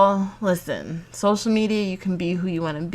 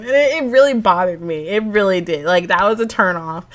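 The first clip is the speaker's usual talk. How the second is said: usual speaking voice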